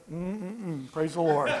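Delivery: soft, strained hum then a mild praise; holding it in. Retold or imitated. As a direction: swallow the anger behind a quiet praise. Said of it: "inside I was like".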